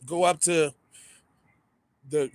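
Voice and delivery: ordinary talk, nothing marked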